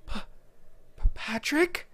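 high, stammering, frightened question; scared and awestruck, rising at the end; shocked, fearful awe. A narrator's reading. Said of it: "with a mixture of shock, fear, and awe".

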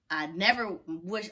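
ordinary talk, nothing marked

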